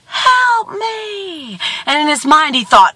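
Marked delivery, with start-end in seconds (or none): 0.1-1.6 drawn-out cry, as from a distance; echoed plea. A narrator's reading. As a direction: imitate an echo coming back. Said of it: "And he hears".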